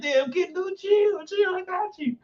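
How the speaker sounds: very high-pitched squeaky protest; mock placating. Comedian answering Kendall's comments, high mock placating. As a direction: squeal it as a joking plea to back off. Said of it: "I'm like".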